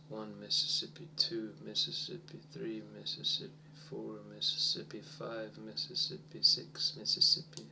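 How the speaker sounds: soft, near-whispered counting. Shown as a demonstration. Teacher counting wait time, softly whispered. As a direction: count the seconds under your breath. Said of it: "counting wait time softly".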